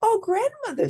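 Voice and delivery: put-on very high, surprised girl's cry; girl exclaiming in wonder; girl noticing something odd; little girl voice. A narrator's reading. Girl addressing disguised villain.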